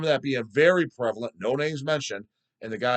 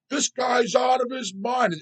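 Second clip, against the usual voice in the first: much higher, slow mock shout; mocking critic; 12 semitones higher, 4 dB louder, slower than usual. Other fans mocking him as crazy, high slow yell. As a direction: yell it high like a scandalized commenter. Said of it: "And then the others".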